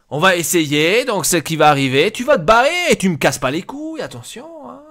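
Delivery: loud, rising angry rant; exasperated telling-off. Acted. Boss ordering a player to leave, exasperated telling-off.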